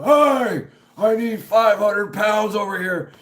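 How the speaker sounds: loud high shout across a noisy plant; yelling over machinery. Retold or imitated. Worker yelling to the plant floor over machinery. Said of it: "I'm having to yell".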